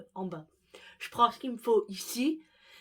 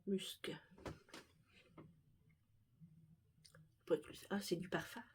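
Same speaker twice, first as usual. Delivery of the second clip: barely audible murmur to self; 10 dB quieter than usual. Real, not acted. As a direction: mumble it to yourself. Sniffing perfume while rummaging.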